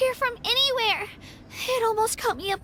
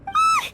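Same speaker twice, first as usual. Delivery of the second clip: startled yelp; 4 dB louder than usual. Acted. Startled by an abyss voice.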